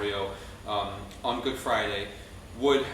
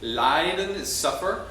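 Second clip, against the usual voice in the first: ordinary talk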